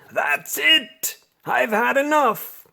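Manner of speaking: high, loud furious outburst; angry king shout. Acted. The king loses patience with the cats, angry shout. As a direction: bellow it like a fed-up king.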